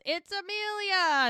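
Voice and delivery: high, loud and slow, stretching the name out; yelled back through the door at the departing guest; yelling her name. Retold or imitated. Yelling her name, high, loud and drawn out. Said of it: "She yells".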